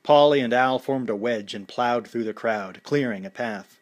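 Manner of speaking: ordinary delivery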